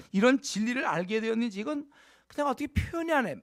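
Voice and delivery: ordinary delivery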